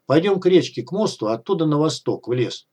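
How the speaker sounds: ordinary delivery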